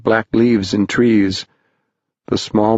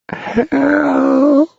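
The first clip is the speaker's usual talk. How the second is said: high, long-drawn wail for help; sad wail; 3 dB louder than usual. A narrator's reading. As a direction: wail it out, stretched and pitched way up. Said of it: "wailed his sad voice".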